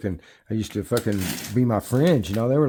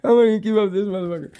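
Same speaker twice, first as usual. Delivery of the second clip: mock determined self-quote; 9 semitones higher than usual.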